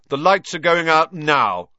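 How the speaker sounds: high, loud prefect's call; shouting. A narrator's reading. Percy announcing lights out to the hall, shouting. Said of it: "Percy shouted".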